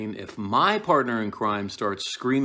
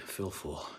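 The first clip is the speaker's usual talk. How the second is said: serious; 9 dB quieter than usual. Shown as a demonstration. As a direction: say "Fill full" quietly, keeping it grave. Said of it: "not to lean into the comedy".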